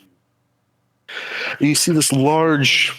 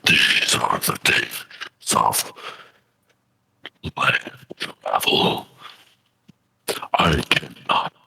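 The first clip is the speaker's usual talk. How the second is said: deep creature voice; 13 semitones lower, 5 dB quieter than usual. Acted. Kraken warns the player before fading away. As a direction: speak low and heavy from the abyss. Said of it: "And he says".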